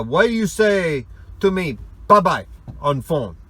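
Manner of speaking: put-on loud, high puzzled Russian-accented question. Russian coworker asking his boss about bye-bye, loud and puzzled. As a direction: demand it like a baffled Russian friend.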